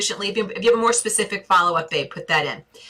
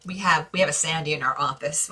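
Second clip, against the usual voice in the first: normal talking voice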